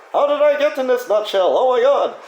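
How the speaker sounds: hammed-up Austin Powers voice with the wrong British accent; Austin Powers impression. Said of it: "Austin Powers for you, but in totally wrong accent".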